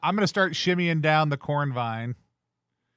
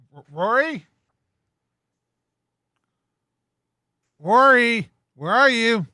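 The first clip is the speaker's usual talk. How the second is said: tentative call building to a louder, higher shout; yelling out; 4 semitones higher, 7 dB louder than usual. Acted. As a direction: call out for a missing companion. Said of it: "Start just yelling out".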